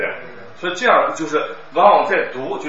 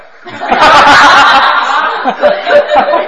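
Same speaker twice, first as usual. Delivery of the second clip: loud laugh; 15 dB louder than usual. Real, not acted. Teacher laughs mid answer.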